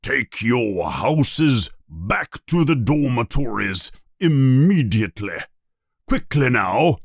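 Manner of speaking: stern headmaster giving orders. Acted. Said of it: "he says in a stern voice".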